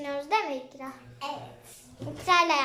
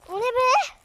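much higher, startled cry; 8 semitones higher, 4 dB louder than usual. Real, not acted. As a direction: react suddenly to something odd. Startled by something on balcony. (şaşkın)

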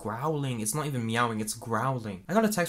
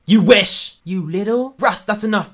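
loud, raised-pitch snapping; angry quarrel; 8 semitones higher, 13 dB louder than usual. Acted. Fighting with Russ until told to stop, angry shouting. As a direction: shout it down forcefully.

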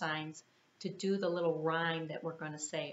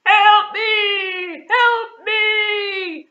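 loud, much higher shout for help; cry for help; 14 semitones higher, 17 dB louder, much slower than usual. Acted. Trapped lion calling for help, loud shouting. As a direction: call out desperately. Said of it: "he hears a strange cry".

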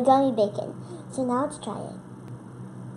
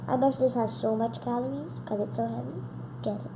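ordinary talk

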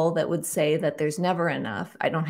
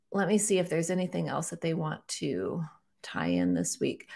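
soft whispered aside to herself; 5 dB quieter than usual. Real, not acted. Host checking with her guides in a whispered aside. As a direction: whisper it while checking something.

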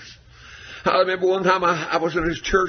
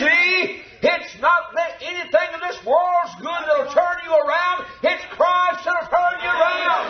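shouted preaching; 11 semitones higher, slower than usual. Real, not acted. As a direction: hammer it home to the church. Preaching at full force.

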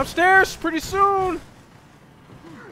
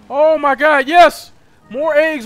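excited cheer; 10 dB louder than usual. Real, not acted. Celebrating a pickup.

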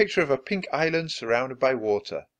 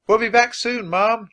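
higher, louder call to another room; 9 semitones higher, 6 dB louder than usual. Acted. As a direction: call it out to Mom in the next room. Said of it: "Jack called into the living room".

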